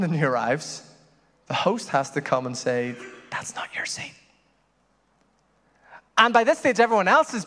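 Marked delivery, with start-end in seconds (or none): 3.3-4.1 hushed whisper. Pretend. Host whispering to the guest to move. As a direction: whisper it so only they hear.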